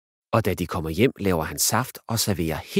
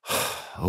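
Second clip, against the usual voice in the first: soft, breathy resigned sigh; 6 dB quieter than usual. Acted. Dad sighing after being told he's embarrassing, soft sigh. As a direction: let the air out, giving up. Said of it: "siger far og sukker".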